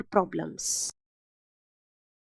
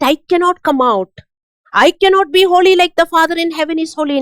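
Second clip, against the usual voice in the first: mock despairing quote; 6 semitones higher, 7 dB louder, faster than usual. Mocking a defeated believer.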